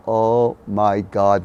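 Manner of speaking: very slow, word-by-word "Oh my God"; drawn-out realization. Retold or imitated. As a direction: stretch each word of "Oh my God".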